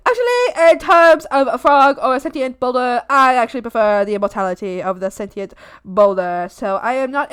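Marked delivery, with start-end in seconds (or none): none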